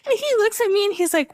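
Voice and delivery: ordinary talk, nothing marked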